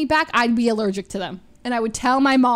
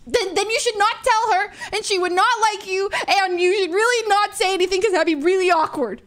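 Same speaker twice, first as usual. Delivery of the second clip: panicked high squeal, louder and rushed; freaking out as a seventh grader when the crush likes her back; freaked out; 9 semitones higher, 4 dB louder than usual. Retold or imitated. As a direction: blurt it out in a high-pitched panic. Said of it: "I literally freaked out".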